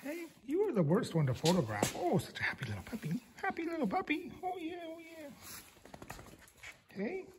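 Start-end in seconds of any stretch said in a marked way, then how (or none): 1.9-3.2 put-on breathy whispered baby talk; cooing whisper. Cooing to the puppy. 3.3-7.2 very high, soft squeaky puppy voice; squeaky baby talk. Acted.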